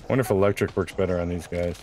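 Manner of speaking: ordinary delivery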